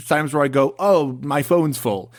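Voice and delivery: ordinary delivery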